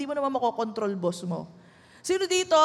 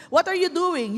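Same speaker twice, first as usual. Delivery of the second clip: normal talking voice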